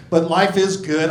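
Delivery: usual speaking voice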